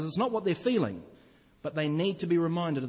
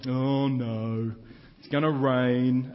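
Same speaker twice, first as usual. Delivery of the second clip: lower, louder, slow mopey donkey moan; gloomy Eeyore; 3 semitones lower, 4 dB louder, much slower than usual. Retold or imitated. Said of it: "donkey who's always going".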